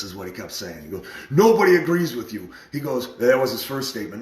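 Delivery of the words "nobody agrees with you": loud, high retold accusation; verbal attack. Friend putting the speaker down, heated attack. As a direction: imitate a heated put-down, voice raised. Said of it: "He's like, just attack".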